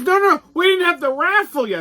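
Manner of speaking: pleading organizer. Retold or imitated. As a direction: squeal about the raffle.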